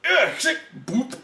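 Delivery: high laughing mock grunts; mock grunting effort. Mocking the midwife scene with laughing grunts. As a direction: play Ron Perlman's grunts for laughs, high and loud. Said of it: "he's like".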